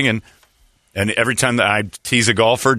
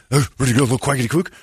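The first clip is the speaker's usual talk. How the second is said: goofy voice; 3 semitones higher, much faster than usual. Retold or imitated. Pablo's goofy greeting voice.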